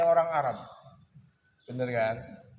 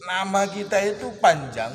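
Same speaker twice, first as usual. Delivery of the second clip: laughing; 6 semitones higher, 8 dB louder than usual. Real, not acted. Tertawa menyindir nama panjang.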